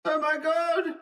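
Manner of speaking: very high, distant wail of pain; agonized cry. A narrator's reading. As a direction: wail it thinly, as if from out in the fog. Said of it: "a voice in the last extremity of unutterable pain".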